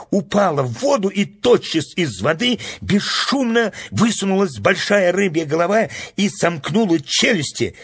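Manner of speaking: high-pitched, thrilled storytelling; excited narration. A narrator's reading. Narrator telling of the snail snatched by a fish, excited. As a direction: tell it breathlessly eager, voice held high.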